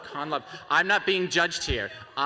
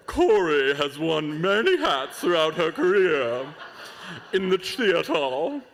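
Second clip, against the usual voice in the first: put-on a light, louder British accent; 3 dB louder than usual. Announcer reads the judge bio to the crowd, in mock British accent. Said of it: "in British accent".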